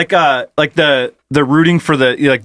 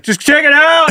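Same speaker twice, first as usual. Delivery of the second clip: mock heckler; 11 semitones higher than usual. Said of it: "You're like".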